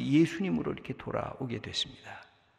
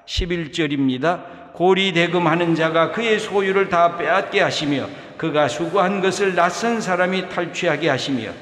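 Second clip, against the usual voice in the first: forceful, louder reading of the psalm; loud scripture reading; 4 semitones higher, 8 dB louder than usual. Acted. Pastor reading Psalm 109 aloud to the congregation, forceful. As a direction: read the curse verses out with full force.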